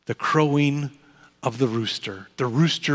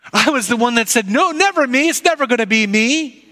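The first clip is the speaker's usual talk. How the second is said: higher, quicker voice of a nervous Peter pleading innocence; Peter, anxious and defensive; 8 semitones higher, faster than usual. Acted. Peter to the courtyard crowd, denying he knows Jesus, nervous and higher. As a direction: play Peter insisting he's just a bystander, pitched up. Peter recalls his boast.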